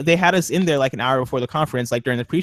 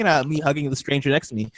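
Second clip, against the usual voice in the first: ordinary talk, nothing marked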